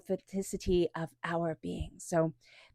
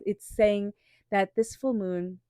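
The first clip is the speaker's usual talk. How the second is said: usual speaking voice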